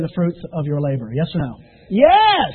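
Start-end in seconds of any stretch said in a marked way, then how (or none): 1.9-2.5 high, loud shout of yes; rally the crowd with a yes. Real, not acted. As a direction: call it out to the room. Audience answer, calling out.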